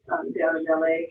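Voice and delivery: ordinary delivery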